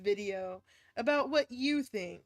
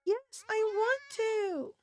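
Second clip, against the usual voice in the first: put-on earnest child; 12 semitones higher, 4 dB louder than usual. Child telling mother he wants to meet father, high earnest voice. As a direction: say it like a sincere young son.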